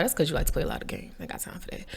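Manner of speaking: quick, very quiet retort, fading out; muttered aside. Retold or imitated. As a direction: toss it off fast under your breath.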